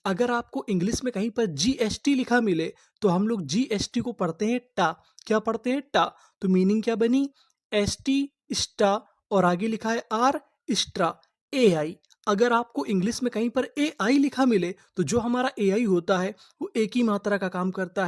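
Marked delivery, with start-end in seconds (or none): none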